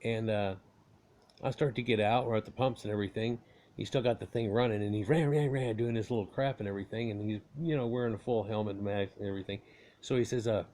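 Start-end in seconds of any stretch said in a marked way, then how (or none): 5.1-5.8 higher-pitched vroom noises; imitated engine revving. Storyteller mimicking the biker revving at the pumps, imitated engine noise. Imitating the idling motorcycle being revved.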